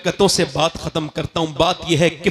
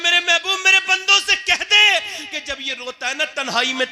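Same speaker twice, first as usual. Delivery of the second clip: voice of God; 10 semitones higher than usual. Acted. God's words voiced high.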